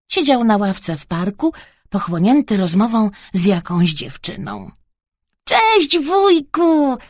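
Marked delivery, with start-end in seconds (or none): 5.4-7.0 put-on high, drawn-out child's hail; called-out greeting. A narrator's reading. Boy greeting uncle in the park, calling out. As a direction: boyish call from a distance. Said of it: "krzyknął Bartuś".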